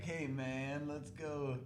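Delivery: very soft, small put-on voice; quiet impression of a laid-back guy; imitating a character's voice. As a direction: drop to a hushed character voice. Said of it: "the guy who talks like this".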